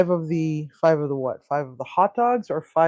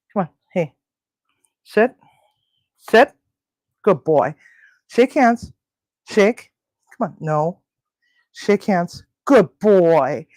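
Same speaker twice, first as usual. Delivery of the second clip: firm pet commands; 2 semitones higher, 6 dB louder, slower than usual. Real, not acted. Commanding cat to shake.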